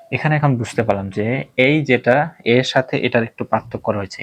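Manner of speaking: usual speaking voice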